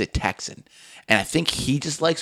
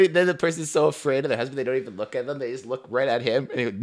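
ordinary talk

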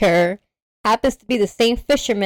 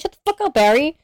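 sassy; 7 semitones higher than usual. Retold or imitated. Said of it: "She was the sassy one".

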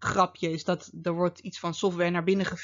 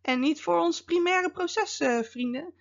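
higher-pitched mock objection; protesting CISO; 6 semitones higher than usual. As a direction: act out a CISO objecting, voice climbing high. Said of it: "dan denk je als ciso zijn".

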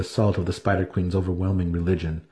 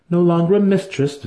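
higher, louder haughty priestess; proud priestess; 10 semitones higher, 5 dB louder than usual. Acted. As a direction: speak as a proud high priestess. Vienna's voice in the story.

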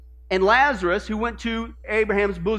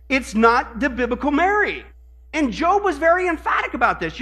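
ordinary delivery

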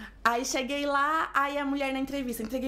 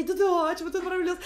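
acted-out delight, higher and louder; reply as if thrilled by the news; 6 semitones higher, 4 dB louder, faster than usual. New hire answering the airline's call, thrilled.

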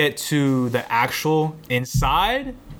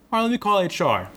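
high-pitched mock clerk voice; imitated clerk; 8 semitones higher, faster than usual. Clerk tells employee she'll phone HR, imitated officious helpfulness. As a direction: put on a higher office-clerk voice.

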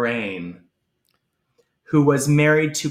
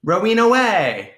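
loud, high catchphrase call; hammed-up cheer with a laugh; playful shout; 5 semitones higher, 5 dB louder than usual. Calls out a Jazzercise catchphrase.

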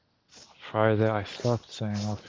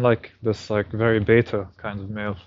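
usual speaking voice

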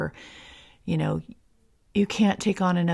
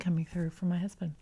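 hushed whisper under an announcement; 8 dB quieter than usual. Real, not acted. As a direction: drop to a soft whisper. Announcement interrupts, she goes hushed.

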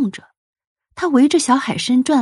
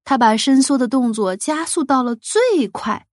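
usual speaking voice

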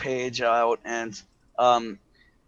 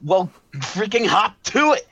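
higher, exasperated command; impatient order; 6 semitones higher, 4 dB louder than usual. A narrator's reading. Director ordering a hesitant crewman to move, exasperated. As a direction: push them to get moving, pitch jumping.

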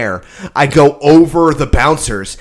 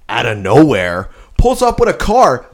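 usual speaking voice

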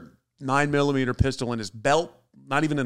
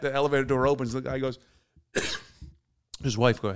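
ordinary talk

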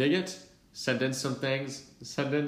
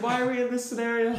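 much higher, fast, laughing question; laughing disbelief; 13 semitones higher, 4 dB louder, faster than usual. Retold or imitated. Narrator asking friends why they are in this scenario, laughing disbelief. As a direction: giggle it out in disbelief.

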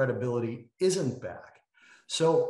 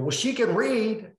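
high, slow mocking voice; mocking quote; 11 semitones higher, 6 dB louder, slower than usual. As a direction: mimic her high and slow. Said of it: "she just started going".

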